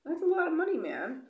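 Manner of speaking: a high, loud jump on the cost; high, loud disbelief at the quarantine bill; incredulous exclaim. Real, not acted. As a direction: stress how costly it is. Stress over quarantine cost.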